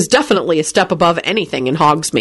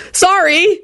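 high, quick shouted apology; 12 semitones higher than usual. A narrator's reading. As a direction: blurt a hurried shout of sorry. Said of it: "they both shouted".

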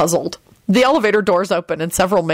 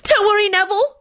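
put-on very high girlish shriek. A narrator's reading. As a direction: shriek it at the top of your range. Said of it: "Rosie shrieked".

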